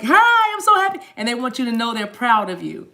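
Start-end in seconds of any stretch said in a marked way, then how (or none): 0.0-1.0 high, loud, gleeful greeting; imitated overjoyed squeal of hello; excited greeting. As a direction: voice thrilled spirits bursting to say hi. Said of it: "They're like".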